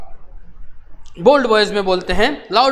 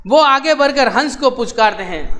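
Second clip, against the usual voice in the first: ordinary delivery, nothing marked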